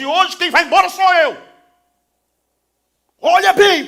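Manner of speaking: loud, high, furious outburst at his father; furious son. Acted. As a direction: hurl it at the father in full rage.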